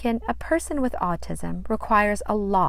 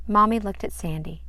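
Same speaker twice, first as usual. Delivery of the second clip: plain reading voice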